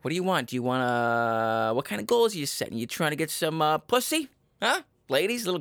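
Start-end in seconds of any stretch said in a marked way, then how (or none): none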